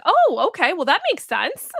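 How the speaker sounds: amused surprise at the guidance; surprised realization. Retold or imitated. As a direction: act out the lightbulb moment. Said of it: "And I was like".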